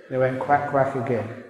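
usual speaking voice

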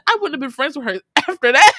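laughing aside. Real, not acted. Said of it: "I said".

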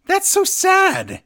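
high, slow, drawn-out mock pity. Real, not acted. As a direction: stretch it out in sing-song sympathy. Pitying Sami's situation.

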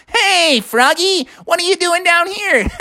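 very high cartoon sponge voice; cheery SpongeBob. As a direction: greet a pal in a squeaky voice. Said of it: "SpongeBob popped out".

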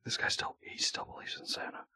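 unvoiced conspiratorial whisper. Real, not acted. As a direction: whisper so he can't hear. Whispered aside.

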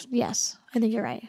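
soft unvoiced whisper conceding; admitting a colleague is right, in a whisper; whispered admission. Real, not acted. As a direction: breathe it out, conceding quietly.

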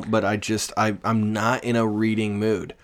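usual speaking voice

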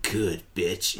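very low, soft bass drop; mock bass voice. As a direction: drop it deep and quiet, imitating her. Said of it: "And she said it with bass".

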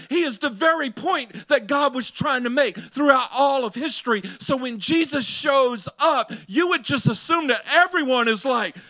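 high, loud preaching climb; full-force preaching. Acted. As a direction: preach it out to the congregation.

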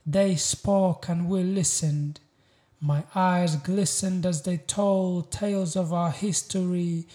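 proud, weighty spoken-word delivery; spoken-word poem. Acted. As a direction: declaim each line with deliberate pauses. Recites a poem.

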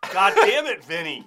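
fed-up rocker cursing his friend. Retold or imitated. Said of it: "how many times [name]'s like".

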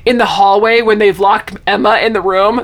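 ordinary talk, nothing marked

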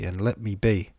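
ordinary delivery, nothing marked

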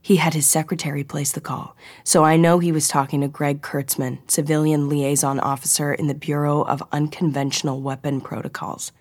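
ordinary delivery

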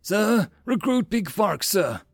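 high, slow military reply; cowed recruit sounding off; obedient recruit. A narrator's reading. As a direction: call out the answer stretched and formal. Recruit answers screaming officer.

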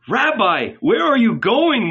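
high, loud, urgent call; alarmed cry. A narrator's reading. Disciples falling upon the rabbi, crying out. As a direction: cry out to hold him back. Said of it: "we cried".

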